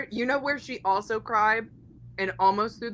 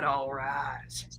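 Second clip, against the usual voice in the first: whispering; 6 semitones lower, 7 dB quieter than usual. Shown as a demonstration. Repeated, third take whispered.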